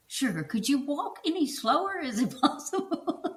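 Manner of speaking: high-pitched retold plea, amused; mock exasperation. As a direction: imitate an exasperated husband, on the edge of laughter.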